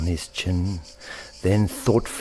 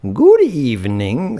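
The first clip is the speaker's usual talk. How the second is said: put-on higher, louder, a light wise old rabbit; wonderfully wise voice; 7 semitones higher, 7 dB louder than usual. The wise rabbit welcoming Thorpe with a wise greeting. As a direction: greet him kindly and knowingly. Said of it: "said a wonderfully wise voice".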